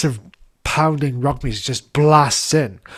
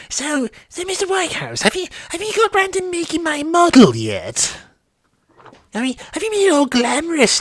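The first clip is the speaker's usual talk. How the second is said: much higher, put-on Daisy voice; 4 dB louder than usual. [name] as Daisy asking about her model, high falsetto. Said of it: "in Daisy's voice".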